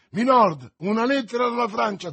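high, loud, urgent call; excited servant crying out; shouted announcement. A narrator's reading. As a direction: burst in shouting the news. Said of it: "Patrick entrava nella stanza gridando".

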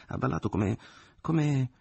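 splutter, hunting for an insult; mother too indignant to find the word; flustered indignation. Acted. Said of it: "nel disordine del suo sdegno non lo trovò".

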